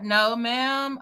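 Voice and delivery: ordinary delivery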